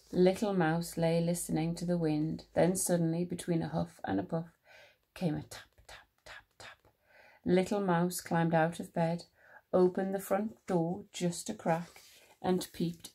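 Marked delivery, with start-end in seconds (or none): 5.4-6.8 hushed, whispered taps; whispered tapping. A narrator's reading. Narrator imitates tapping in the night, whispered. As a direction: whisper each tap lightly.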